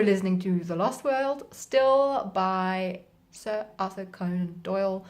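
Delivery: normal talking voice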